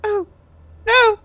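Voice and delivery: high-pitched frightened little cries; cowering plea. Acted. Game master as the impostor cowering under blows, afraid. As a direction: shrink back with short, high pleas. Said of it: "he cowers before you and he goes".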